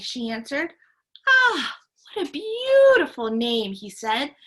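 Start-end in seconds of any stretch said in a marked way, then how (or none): 1.3-3.7 high, loud delighted ah; light up with joy; delighted exclamation. Acted. Grocer delighted by the girl's name, loud and high.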